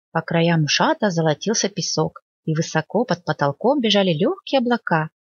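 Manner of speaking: usual speaking voice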